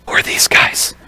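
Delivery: unvoiced whisper to a friend; whispered aside. [name] quietly asking Freddie about the men, whispering. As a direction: ask it under your breath, bewildered.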